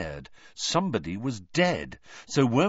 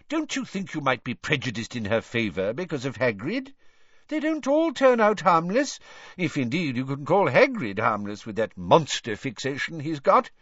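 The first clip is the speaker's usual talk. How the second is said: 1 Fudge; 6 semitones higher than usual. A narrator's reading. Fudge character voice.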